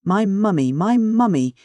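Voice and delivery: 大事な物を巡って怒りの叫び; insistent furious outburst. A narrator's reading. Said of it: "he rapped the wood furiously".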